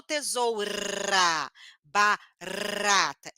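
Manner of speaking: TESOURA, BARATA with a long rolling R; exaggerated trilled R. Shown as a demonstration. Teacher showing what is not necessary, trilled R. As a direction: draw out a vibrating R in each word. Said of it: "eu não preciso vibrar a língua".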